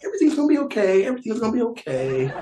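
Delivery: very high, fast mock-sweet nun voice; soothing nun. Nun lying to the chained villager, very high and mock sweet. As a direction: squeak out false comfort like a sugary nun. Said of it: "She's like".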